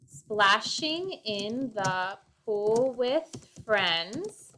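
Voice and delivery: slow, deliberate, each syllable set apart; slow syllable count. Shown as a demonstration. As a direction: spell out the beats of the line slowly. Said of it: "Let's do that".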